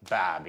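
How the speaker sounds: low "Barbie" with an open "ah", no R; copied Australian pronunciation. Shown as a demonstration. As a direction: echo the coach's Australian "Barbie", pitched low. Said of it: "We say Barbie".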